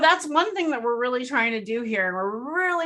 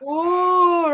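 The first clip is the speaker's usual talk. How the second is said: usual speaking voice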